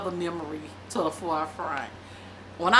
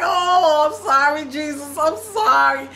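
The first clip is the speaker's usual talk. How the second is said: loud, high, sobbing "I'm sorry"; crying apology; 7 semitones higher, 9 dB louder than usual. Retold or imitated. Teenager crying to Jesus at the altar, loud sobbing apology. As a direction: wail it out like a repentant teen. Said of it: "I'm like".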